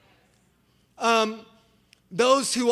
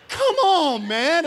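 exasperated outcry; 8 semitones higher, 4 dB louder, slower than usual. Retold or imitated. Protesting the dog's death.

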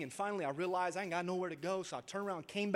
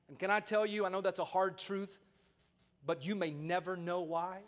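ordinary talk

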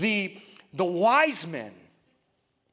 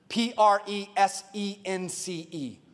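slow, deliberate spelling; spelled out letter by letter; slower than usual. Real, not acted. Preacher spells the key word for the congregation, slow and deliberate. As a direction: spell it out slowly, each letter separate.

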